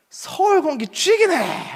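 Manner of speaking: loud, very high and slow Gyeongsang exclamation; Gyeongsang dialect. Retold or imitated. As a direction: blurt it like a born Busan native. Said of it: "내가 네이티브 스피킹이 되었구나".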